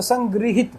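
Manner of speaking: संग्रहित as "sang-gri-hit"; deliberate "sang-grii-hit" for संग्रहित; correct pronunciation. Shown as a demonstration. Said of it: "इसको पढ़ेंगे".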